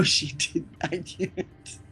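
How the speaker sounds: normal talking voice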